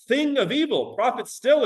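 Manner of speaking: thing of evil pitched high and loud; dramatic declaiming; dramatic high reading. Shown as a demonstration. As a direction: cry it out high. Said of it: "whether tempter sent or".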